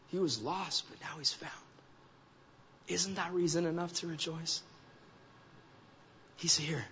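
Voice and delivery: hushed, tender fatherly pleading; quiet, earnest coaxing with pauses; gentle fatherly reassurance. Acted. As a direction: soften right down to reason with a son. Father gently reasoning with older son.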